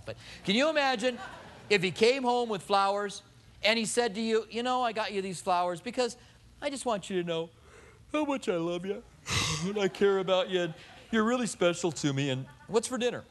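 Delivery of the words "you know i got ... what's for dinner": breezy, offhand compliments with a hook; butter her up on the way to dinner; lightly voiced husband after dinner, casual and hollow; insincere sweet talk. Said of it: "You're gonna throw the flowers at him".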